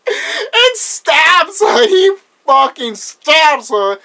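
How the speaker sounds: very high, loud, drawn-out laughing squeal; cracking up. Real, not acted. Fan recapping the stabbing scene, cracking up. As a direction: retell it while losing it laughing.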